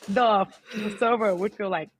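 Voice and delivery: ordinary talk, nothing marked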